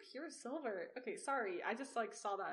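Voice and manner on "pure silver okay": normal talking voice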